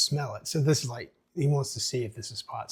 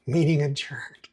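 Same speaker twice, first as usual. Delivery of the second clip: whispered; 3 semitones higher, 3 dB louder than usual. Acted. Mayor closes meeting.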